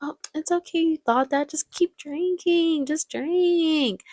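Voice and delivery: high, drawn-out coaxing voice; imitating a seductress urging a drink; coaxing Mrs. Robinson. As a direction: coax them sweetly to keep drinking. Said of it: "and she's like".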